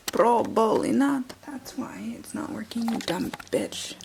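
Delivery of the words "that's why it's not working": soft unvoiced whisper; hushed muttered asides. Real, not acted. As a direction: whisper as if not to wake anyone. Whispering to camera.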